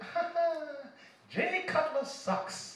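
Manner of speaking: high, slow taunting lady voice; mocking coworker. Comic voicing a coworker mocking his Bears, high and slow taunt. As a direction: tease him high and slow, relishing it. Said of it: "She's like".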